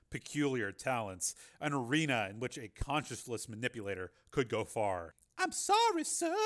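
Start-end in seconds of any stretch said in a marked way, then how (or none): 5.4-6.4 much higher, polite secretary voice; the flustered secretary apologizing; apologetic secretary. A narrator's reading. Secretary apologizing to her boss as Jennifer barges in, higher voice. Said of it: "his secretary said".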